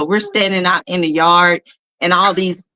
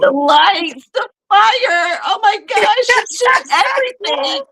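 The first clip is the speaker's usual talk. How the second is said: much higher, wailing drama-queen voice; mock melodramatic sobbing, much higher; dramatic crying girl; 14 semitones higher, slower than usual. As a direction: overact the tears like a little drama queen. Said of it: "this is not the time to be dramatic".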